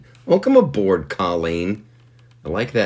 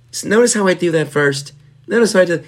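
showy brag; 11 semitones higher, 5 dB louder, much faster than usual. Real, not acted. Brags about his story order.

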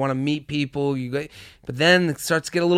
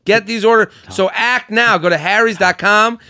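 loud, pumped-up sponsor pitch; hyped ad read; 5 semitones higher, 4 dB louder than usual. Acted. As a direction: sell it like a radio ad. Ad read with hype.

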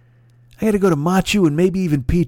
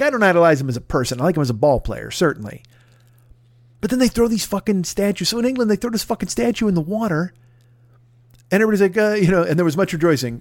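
ordinary talk, nothing marked

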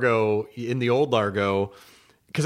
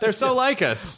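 mock gushing headline; 7 semitones higher than usual. Mock magazine headline repeated.

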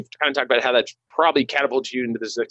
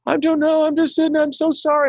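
much higher, imitating a panicked caller; flustered telemarketer. Telling a prank story, host imitates a flustered telemarketer's high, panicked apology. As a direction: squeak out a frightened apology.

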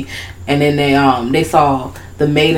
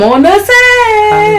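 much higher, very slow amazed; high slow doll voice; 3 dB louder, much slower than usual. Retold or imitated. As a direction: savour the arrival like a puppet. Voicing Shitface.

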